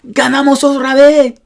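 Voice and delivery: high, loud victory cheer; celebrating. Shown as a demonstration. Teacher acting out a repeated victory, celebrating loudly. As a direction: cheer it out like a winning fan. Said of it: "celebrating a repeated victory".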